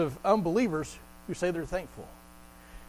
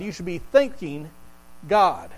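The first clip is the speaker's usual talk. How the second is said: ordinary talk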